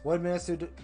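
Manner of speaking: ordinary talk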